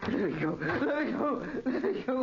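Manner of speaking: high desperate plea; panicked pleading. Acted. Captive man pleading with his captors, high panicked. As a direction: beg to be released.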